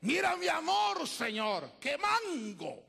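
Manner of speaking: loud, high showing-off prayer; a proud churchgoer shouting about his fruit; boastful believer. Acted. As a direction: brag to God at full voice.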